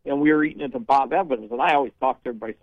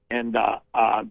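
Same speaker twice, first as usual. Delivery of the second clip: normal talking voice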